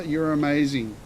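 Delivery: ordinary delivery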